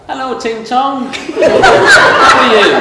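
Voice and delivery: high loud slow call laughing through it; shouting over the bar. Retold or imitated. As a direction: call across a noisy bar, drawn out and laughing. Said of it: "and shouts over".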